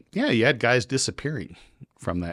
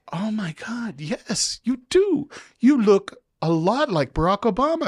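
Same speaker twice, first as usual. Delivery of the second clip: much higher, gushing agreement; mock eager agreement; 13 semitones higher, 4 dB louder than usual. Traveler humoring the officer, high gushing agreement. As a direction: overdo the flattery.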